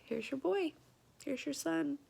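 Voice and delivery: gently imitating nurses presenting a newborn; tender retold voice. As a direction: present the baby softly and sweetly.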